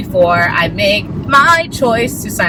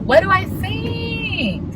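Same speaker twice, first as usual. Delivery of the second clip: high, drawn-out sing-song question; soft, playful high lilt; playful musing; 13 semitones higher, 5 dB quieter, much slower than usual. Pretend. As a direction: stretch it out high and softer. Echoes own question playfully.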